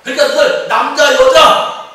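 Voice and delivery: loud, high, commanding ruler's voice; Pharaoh. Acted. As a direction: proclaim it as a king giving orders, punching each group. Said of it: "바로가 뭐라고 그래요?". (왕이 명령하듯 높고 크게 외치며)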